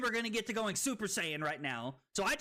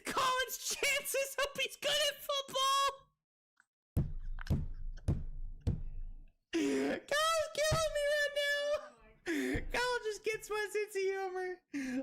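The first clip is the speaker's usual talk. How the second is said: laughing hard; slower than usual. Real, not acted. Streamer cracking up at Kyle's joke, talking high through laughter. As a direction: squeal the words through laughter.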